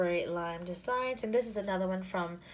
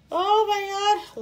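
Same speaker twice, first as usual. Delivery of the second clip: much higher, louder, drawn-out delight; delighted gasp; 13 semitones higher, 13 dB louder, slower than usual. Real, not acted. Vlogger seeing foil headers, bursting with delight. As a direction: let the thrill burst out.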